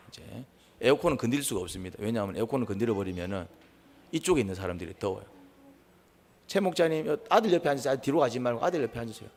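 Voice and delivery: usual speaking voice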